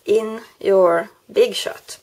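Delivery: usual speaking voice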